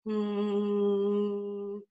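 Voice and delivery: easy buzzing hum; relaxed hum. Shown as a demonstration. Coach demonstrating a relaxed resonant hum. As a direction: hum softly with a front buzz. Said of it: "an easy production where these muscles are pretty relaxed".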